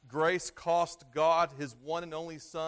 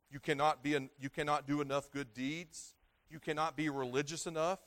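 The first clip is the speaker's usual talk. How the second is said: ordinary talk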